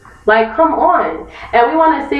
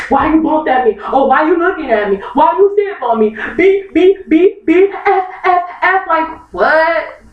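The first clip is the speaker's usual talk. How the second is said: mock confrontation; 6 semitones higher than usual. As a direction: act out a petty train squabble with bleeped curses.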